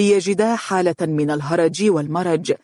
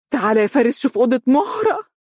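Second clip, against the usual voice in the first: high, urgent alarmed mother; 8 semitones higher, faster than usual. A narrator's reading. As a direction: summon your son in alarm, pitch climbing. Alarmed mother calls her son. (بفزع وإلحاح)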